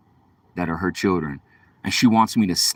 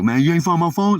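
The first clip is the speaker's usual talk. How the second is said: higher-pitched pushy demand; aggressive questioning; 7 semitones higher, 3 dB louder than usual. Retold or imitated. Stranger asking about his lost phone, aggressive. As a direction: imitate a man pressing for an answer. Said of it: "he was getting aggressive".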